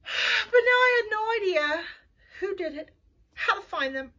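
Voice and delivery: high, loud wail after a sigh; sigh, then lament helplessly; despairing. A narrator's reading. Narrator despairs over the mystery.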